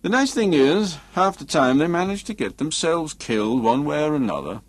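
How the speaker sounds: scornful Jensen. Acted.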